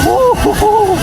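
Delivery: high gleeful woo-hoo; excited whoop. Real, not acted. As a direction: whoop with joy. Whooping on a fast ride.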